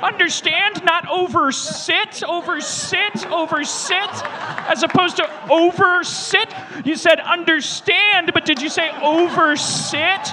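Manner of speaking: high, loud and drawn-out pestering; mock badgering. Comic pestering someone over understand and oversit, loud mock badgering. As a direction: badger them slowly at the top of the voice. Badgering bit, repeating a phrase loudly.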